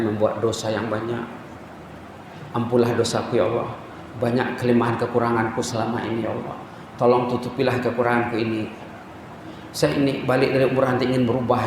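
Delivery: low, soft pleading prayer to God; tearful prayer. Retold or imitated. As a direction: pray it humbly, voice low and soft. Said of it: "Apa doanya?". (doa memohon dengan suara rendah dan lembut)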